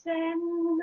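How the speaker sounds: high, soft mock-serene monk; calm monk voice. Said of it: "just going".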